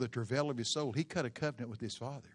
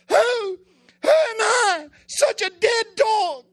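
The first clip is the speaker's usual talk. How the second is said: high, loud, anguished wail of self-abasement; crying out; 18 dB louder, slower than usual. Acted. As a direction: cry it out in shame and grief. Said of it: "he cried out".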